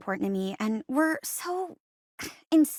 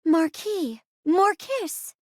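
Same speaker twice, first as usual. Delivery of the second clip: slow, higher, each word drawn out; theatrical title reveal; 4 semitones higher, 3 dB louder, much slower than usual. Acted. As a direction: present the romance title slowly with a flourish. Host announces a romance title.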